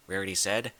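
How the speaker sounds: ordinary delivery, nothing marked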